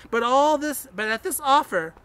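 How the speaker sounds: plain reading voice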